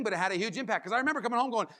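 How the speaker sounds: ordinary talk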